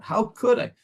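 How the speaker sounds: pitched high, pained outrage; heartfelt self-reproach; outraged cry. Retold or imitated. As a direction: cry out at yourself in disbelief. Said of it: "There's gotta be a bit of an outrage".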